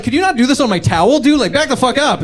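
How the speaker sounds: annoyed push-back. Acted. Telling off nude guy.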